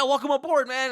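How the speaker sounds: high, loud greeting; hammed-up enthusiastic coworker; hearty welcome. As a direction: cheer the newcomer aboard. Acted coworker welcoming newcomer.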